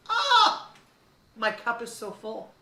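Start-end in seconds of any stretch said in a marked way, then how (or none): 0.1-0.7 high, loud squeal of delight. Real, not acted. Mother melting at her son's call, delighted squeal. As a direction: let out a bright, loud squeal of joy.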